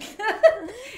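high burst of laughter; cracking up; loud laugh. Real, not acted. Laughing at funny logo.

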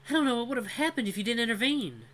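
high, relieved female voice; grateful young woman. Acted. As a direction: thank him with relief. Cora thanks Vul.

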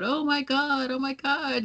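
mock gushing "Oh my God" like a screaming fan; flipped-out fan. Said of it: "so flipped out".

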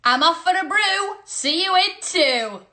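high, playful call-out; sing-song sign-off. Pretend. Signing off to viewers for a tea break, high sing-song. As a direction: sing it out high, stretching the ending.

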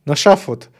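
ordinary delivery

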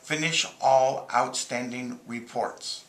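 slow, carefully spoken for dictation; slow clear dictation. Shown as a demonstration. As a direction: speak each word clearly to the phone. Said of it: "And do a split finger tap".

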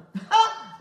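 short, high, loud burst of laughter; sudden loud cackle of amusement. Real, not acted. Laughs after teasing about money.

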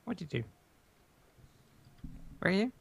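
ordinary delivery

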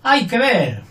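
high, loud hay que ver, falling; hay que ver exclaimed in disbelief; exasperated exclamation. Shown as a demonstration. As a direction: marvel in dismay. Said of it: "O decir".

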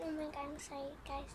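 usual speaking voice

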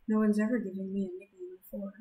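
ordinary delivery

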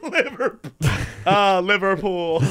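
mock British football fan; imitating British accent. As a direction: echo it in a put-on British drawl. Co-host echoing the British accent.

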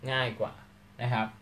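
normal talking voice

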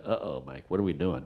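low, hushed imitation of concern; worried aside. Ben to director during a bad take, worried low aside. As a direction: drop it right down, quietly uneasy.

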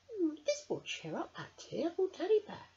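put-on high, hopeful little girl voice. Emily's thought voiced.

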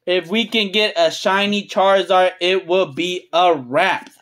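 low, deliberate restatement of "it will be a wrap"; measured emphasis. Real, not acted. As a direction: spell it out word by word, solemnly. Repeats the wish slowly, spelling out.

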